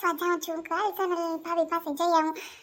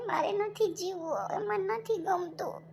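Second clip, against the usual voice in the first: softer, slowing into hopelessness; despairing; 4 dB quieter than usual. Acted. Patient despairs after hospital rescue. (નિરાશ, ધીમો અવાજ)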